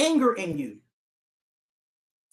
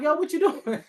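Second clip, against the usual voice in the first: laughing mock surprise; 9 semitones higher than usual. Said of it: "I'm like".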